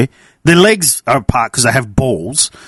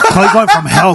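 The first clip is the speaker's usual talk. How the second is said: high, laughing wrestling call; giddy laughing announcer shout. Real, not acted. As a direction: blurt it out through a laugh. Joking about wrestling moves.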